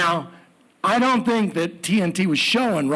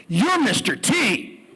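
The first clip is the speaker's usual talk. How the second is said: higher, drawn-out exclamation; excited accusation; 7 semitones higher, slower than usual. Retold or imitated. Peg confronting the celebrity, excited exclamation. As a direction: call him out, high and slow.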